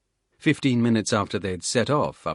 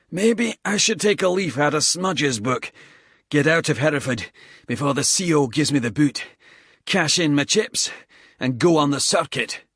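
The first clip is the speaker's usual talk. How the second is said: a tired squaddie cursing the climb between breaths; lightly voiced Scottish soldier, puffing; Bald, winded Scottish soldier; 6 semitones higher, 5 dB louder than usual. Bald musing about leaving.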